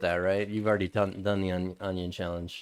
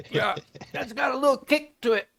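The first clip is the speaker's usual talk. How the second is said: laughing. Real, not acted. Reacting to the onion's burn.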